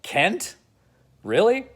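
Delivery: can't believe it's him; incredulous. Retold or imitated. Bruce hearing Lois dates Clark, incredulous.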